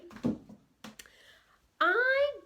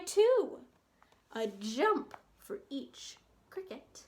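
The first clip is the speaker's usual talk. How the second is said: gentle, lilting read-aloud for little ones; bedtime story reading; 3 semitones higher than usual. A narrator's reading. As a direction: read it like a cozy bedtime book. Reading picture book to children.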